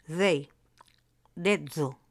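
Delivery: "They" and "dedo" slightly overdone, the th and middle d a voiced interdental fricative; exaggerated soft D. Shown as a demonstration. Teacher likening English they to Spanish dedo, exaggerated fricative D. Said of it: "Exagerándolo un poco".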